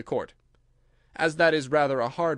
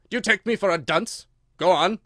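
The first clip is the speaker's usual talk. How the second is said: louder, higher, sharp rebuke; 5 semitones higher, 7 dB louder than usual. A narrator's reading. King rebukes the Hatter and orders him on, sharp. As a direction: snap at the witness. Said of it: "said the king sharply".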